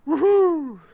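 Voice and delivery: high, loud whoop; excited cheer. Real, not acted. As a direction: whoop in celebration. Cheers the bridge success.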